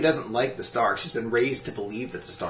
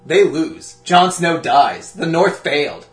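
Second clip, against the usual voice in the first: slow, louder spoiler proclamation; mock spoiler announcement; 6 semitones higher, 11 dB louder, slower than usual. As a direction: announce the doom slowly.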